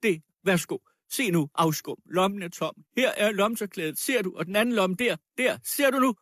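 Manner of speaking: high, urgent bursts; short heated exclamations, rising at the end; agitated, triumphant woman. Acted. Woman showing the girl's empty pockets, agitated and defiant.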